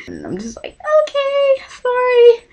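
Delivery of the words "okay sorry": tiny high, soft voice; meek little fangirl squeak; sheepish apology. Retold or imitated. Sheepish apology to idol, retold.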